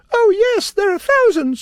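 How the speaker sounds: tiny queen boasting; squeaky pride; proud mouse queen. A narrator's reading.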